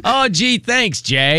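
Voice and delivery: mock-grateful, high-pitched "oh, gee"; exaggerated sarcastic thanks, starting high; mimicked sarcasm. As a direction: imitate an insincere thank-you.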